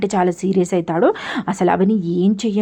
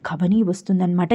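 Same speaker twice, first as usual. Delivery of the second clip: ordinary talk, nothing marked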